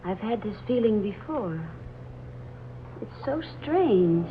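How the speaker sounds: hushed unease. Acted. Laura uneasy in the old house.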